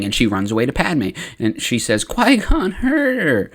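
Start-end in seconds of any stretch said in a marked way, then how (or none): 2.0-3.5 put-on high, drawn-out childish wail; mock crying. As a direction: whine it high as if tattling in tears. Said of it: "like, crying".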